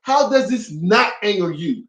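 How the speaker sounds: high-pitched, indignant confrontation; flipping out. Retold or imitated. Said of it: "I was flipping it".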